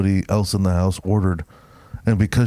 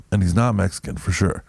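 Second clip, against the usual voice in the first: ordinary delivery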